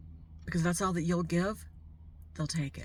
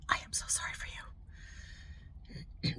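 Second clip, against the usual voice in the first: whispered, softer condolence; sympathetic whisper; 10 dB quieter, faster than usual. Real, not acted. Vlogger telling sick viewers without help she's sorry, whispered. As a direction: lean in and whisper your sympathy.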